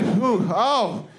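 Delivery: high, impassioned song dedication; hammed-up singer working the audience. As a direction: dedicate it fervently to the crowd. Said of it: "She's like".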